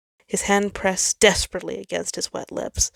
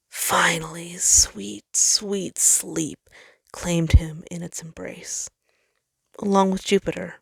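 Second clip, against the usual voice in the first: usual speaking voice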